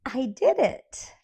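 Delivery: loud, high, slow "I did it"; triumphant. Retold or imitated. Boy behind the counter proud to husband, loud triumphant cheer. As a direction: crow it like winning gold. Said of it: "he smiles and he says".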